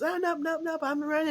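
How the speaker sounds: high, playful dog voice refusing; voicing the corgi. Corgi refusing to come downstairs, high mock dog voice. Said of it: "he goes".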